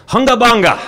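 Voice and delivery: high, loud sing-song taunt; taunting yell. Retold or imitated. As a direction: yell it like a kid mocking across the yard. Kids taunting him by nickname.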